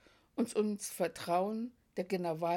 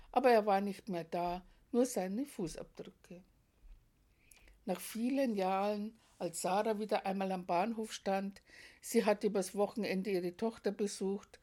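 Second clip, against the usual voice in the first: ordinary delivery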